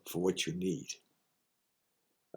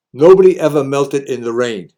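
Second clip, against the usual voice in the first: motto called out loud and higher; loud proclamation to the crowd; loud declaration; 5 semitones higher, 12 dB louder than usual. Shown as a demonstration. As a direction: belt out the saying like a rallying cry.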